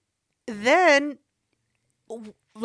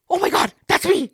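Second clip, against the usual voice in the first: high, dramatized shock; shocked recognition; 6 semitones higher, 4 dB louder than usual. Retold or imitated. Girlfriend recognises her voice on tape, high-pitched shock. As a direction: relive the alarm of recognising yourself. Recognises herself on secret recording.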